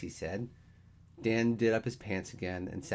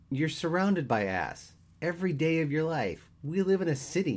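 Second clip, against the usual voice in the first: high, emphatic, raised-voice argument; exasperated; 10 semitones higher, 6 dB louder than usual. Acted. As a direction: press the point in loud disbelief.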